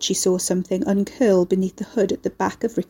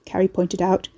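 ordinary delivery

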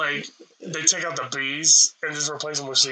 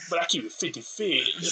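imitating Crip; 7 dB quieter than usual. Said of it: "And then he goes".

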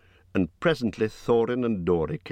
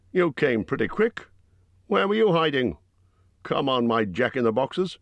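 gruff Bjorn; 7 semitones higher than usual. A narrator's reading. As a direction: voice Bjorn as a blunt giant host. Bjorn voiced by narrator.

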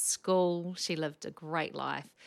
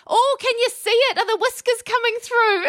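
put-on much higher, louder sweet old lady; 15 dB louder than usual. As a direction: pipe up like a dear old patient. Elderly patient voiced in story.

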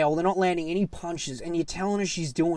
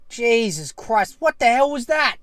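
exasperated outrage; 6 semitones higher, 5 dB louder than usual. Real, not acted. Fan complaining about a snooze fest, outraged. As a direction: demand an explanation, voice climbing.

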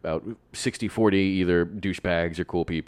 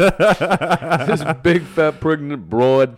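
put-on loud, drawn-out Jersey accent, pitched high; 7 semitones higher, 7 dB louder, much slower than usual. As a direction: ham up a slow Jersey accent through laughter. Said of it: "I went for the Jersey accent for that".

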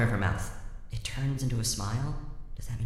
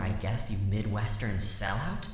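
usual speaking voice

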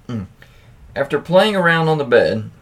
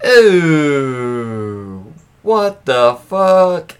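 shocked disgust; 5 dB louder, much slower than usual. Real, not acted. Host reading a gross story, slow shocked disgust. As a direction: let the disbelief stretch out slowly.

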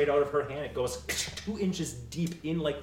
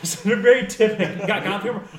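ordinary talk

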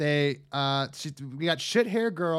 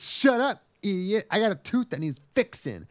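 tsundere snap; 6 semitones higher than usual. Pretend. Anime girl barks orders at her friend. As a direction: bark it like a bratty anime girl. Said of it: "She goes".

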